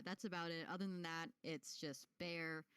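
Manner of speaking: ordinary talk, nothing marked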